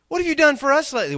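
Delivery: high, loud, fast sneering challenge; mocking demand. Preacher mimicking the crowd demanding proof, sneering. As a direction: toss it off like a smug heckler.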